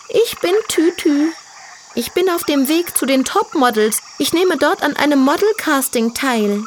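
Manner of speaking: put-on high, vain young piglet voice; girly piglet voice. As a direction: play a proud little diva pig. Tütü the piglet speaks.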